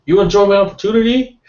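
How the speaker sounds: high, loud, fast mock pitch voice; cartoonish high hard-sell imitation; imitating Eli's sales voice. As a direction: ham up a squeaky, overeager salesman. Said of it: "his voice was like that".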